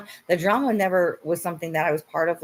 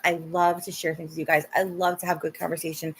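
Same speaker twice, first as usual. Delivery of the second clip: ordinary delivery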